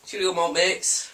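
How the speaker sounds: high, loud, slow farewell; cheery sign-off. Real, not acted. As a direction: belt out a big goodbye. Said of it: "say cheerio to the malt mates".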